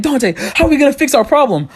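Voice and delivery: high, panicky imitation of a sister; freaking-out sister. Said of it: "My sister's freaking out".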